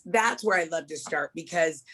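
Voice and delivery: ordinary talk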